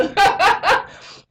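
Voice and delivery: high, loud burst of laughter; real amused cackle. Real, not acted. Laughs at own imitation.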